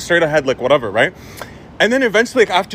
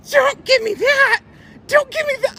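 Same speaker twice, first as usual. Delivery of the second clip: high squeaky mock of an outraged lady; indignant lady. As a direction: imitate her protest way up high. Retelling the angry lady's protest.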